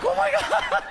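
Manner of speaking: high-pitched retold startled cry; freaked out. Comic recalls being freaked out, high mock panic. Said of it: "It freaked me out".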